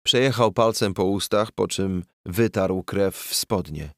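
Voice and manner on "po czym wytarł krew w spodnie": ordinary delivery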